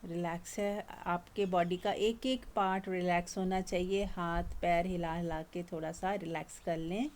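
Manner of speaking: soft, gentle relaxation guidance; soft meditation voice. Acted. Teacher easing the group's bodies, soft guided meditation. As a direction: let the words settle them down.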